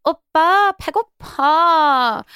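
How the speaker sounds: high, cutesy whining in Korean; 애교 부리는 말투; bratty aegyo girl. Retold or imitated. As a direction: coo it like a bratty girlfriend begging. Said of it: "and they're like this".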